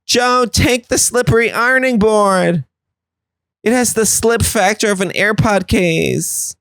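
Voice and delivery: high, stretched-out mock calling; family calling out. Family throwing a paddleboard to a struggling Joe, high mock calling. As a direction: call across the water in a high, slow put-on voice. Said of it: "They go".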